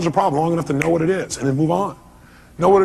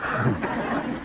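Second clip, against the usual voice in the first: breathy laugh; 8 semitones lower, 10 dB quieter than usual. Real, not acted. After near crash quip.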